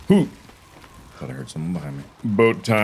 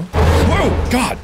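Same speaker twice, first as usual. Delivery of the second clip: sudden high yelp of fright; startled scream; 4 dB louder than usual. Real, not acted. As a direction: jump with a scared whoa. Startled by a fish.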